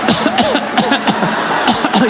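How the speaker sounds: hammed-up coughing fit; repeated mock coughs; threatening fake cough. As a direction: cough at someone on purpose. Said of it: "I'll cough on".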